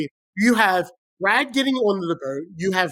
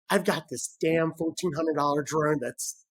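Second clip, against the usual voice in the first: much softer, worried inner voice; anxious mutter; 5 semitones lower, 7 dB quieter than usual. Retold or imitated. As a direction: mutter the fear to yourself. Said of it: "I am sweating going".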